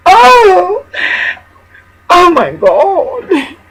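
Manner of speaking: very high shrieked "Oh!" then a slow, high "Oh my God!"; mock shriek of horror. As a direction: shriek it like a scandalized lady, then draw it out. Mock horror at being waved at.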